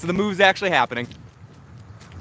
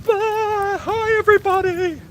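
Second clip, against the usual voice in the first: high greeting; slower than usual. Real, not acted. Greeting chat on a bumpy ride.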